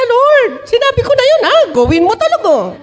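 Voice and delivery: high-pitched, insistent mock pleading; anxious plea. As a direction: press the Lord like someone fretting over a promise. Acts Moses anxiously pressing the Lord. (kunwaring balisang pagsusumamo)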